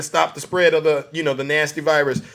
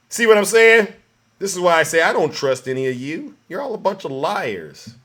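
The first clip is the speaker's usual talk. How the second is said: ordinary delivery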